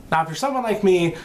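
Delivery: ordinary talk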